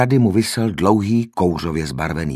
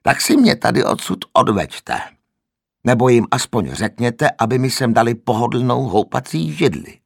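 higher, louder cranky complaint; grumpy old man; 5 semitones higher, 4 dB louder than usual. A narrator's reading. The old man crankily demands a rocking chair, louder. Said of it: "nevrle pokračoval stařec".

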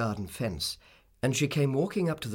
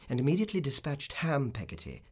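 plain reading voice

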